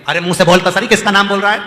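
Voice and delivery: loud, pressing question; insistent demand. Acted. Parvati asking the herdsman whose name he chants, loud and insistent. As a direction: raise the voice and demand an answer.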